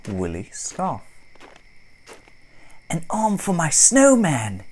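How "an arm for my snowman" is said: put-on high, loud cheerful boy's voice; laughing boy. Boy finding an arm for his snowman, gleeful and loud. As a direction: play the delighted child grabbing a stick. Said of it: "he says with a laugh".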